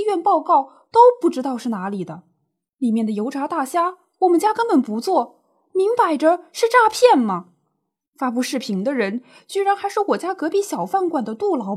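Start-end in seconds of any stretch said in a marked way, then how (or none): 4.2-7.5 rising, louder indignation, higher pitch; acted indignant protest, building louder; indignant outburst. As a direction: fume that it's plainly a scam. Auntie indignant about fake video.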